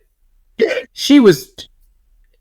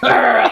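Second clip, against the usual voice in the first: short higher-pitched mock snarl; imitated dog growl; 6 semitones higher than usual. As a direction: growl like a mean little dog. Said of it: "Pookie was like".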